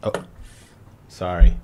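ordinary delivery

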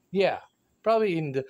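usual speaking voice

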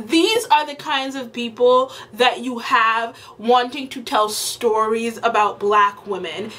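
ordinary talk

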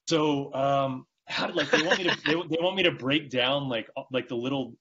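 high, eager imitation of a caller; lightly voiced fan asking; mock dude voice. Imitating dudes in their thirties.

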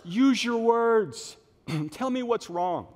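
loud, high, drawn-out "use your words"; exasperated parent. Acted. Parent coaxing a crying child to speak, acted exasperation. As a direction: stretch each word out for a small child, mock exasperation.

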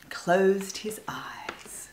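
plain reading voice

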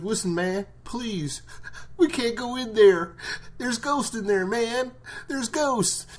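high, pleading frightened voice; scared prisoner. Retold or imitated. Prisoner begging Rick not to go inside, high and scared. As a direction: squeak it out like a spooked prisoner. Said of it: "He's like".

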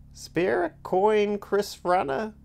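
put-on high, slow wheezy old voice; wheezing old beggar. A narrator's reading. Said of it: "a voice wheezed".

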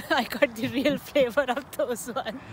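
laughing through the words; chuckling while describing the climb. Real, not acted. Laughing about the descent.

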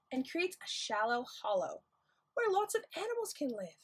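put-on gentle storybook reading for kids; reading to children. Librarian reading a tide pool book to children, gentle storytime voice. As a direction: read the book aloud to little ones.

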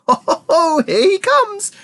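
high, gleeful yell. A narrator's reading. Wonka watching the screen, yelling in excitement. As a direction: whoop it out with a ho-ho-ho. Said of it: "yelled Mister Wonka".

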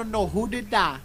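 ordinary talk

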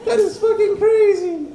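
very high, slow, laughing Jesus voice; Jesus cracking up in disbelief; amazed Jesus. Pretend. Jesus marvelling at a man who died high, squealing.

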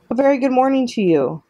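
high, sing-out friendly greeting; chirpy robot greeting carried across water; friendly, booming. A narrator's reading. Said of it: "the robot's friendly voice boomed".

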